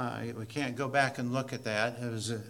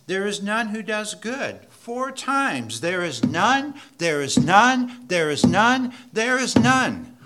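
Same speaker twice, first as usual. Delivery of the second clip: building louder and higher on each none; rising pulpit thunder; preaching at full force; 7 semitones higher, 10 dB louder, slower than usual. Acted. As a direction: drive it home harder every time.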